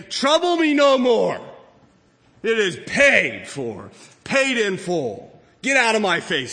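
loud, indignant declaration, high on the opening; defiant shout. Acted. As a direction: throw it back at your accusers, loudly. Acting out defiant shopper with receipt.

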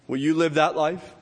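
ordinary delivery

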